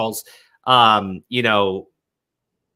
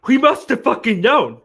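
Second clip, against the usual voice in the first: higher, louder sneering parody; mocking accusers; 8 semitones higher, 4 dB louder than usual. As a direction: mimic the accusers with a raised, scornful voice. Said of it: "But they go like".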